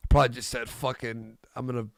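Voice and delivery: ordinary talk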